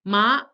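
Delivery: ma rising like a question; second tone ma. Shown as a demonstration. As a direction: lift ma upward as if asking. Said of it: "it sounds like this".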